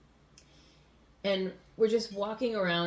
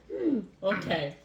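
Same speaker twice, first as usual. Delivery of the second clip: ordinary talk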